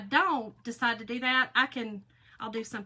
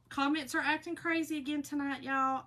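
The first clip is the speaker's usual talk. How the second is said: ordinary talk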